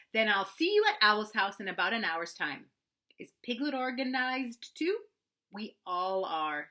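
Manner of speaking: busy, bossy rabbit speaking importantly; Rabbit's voice. Acted. Rabbit again.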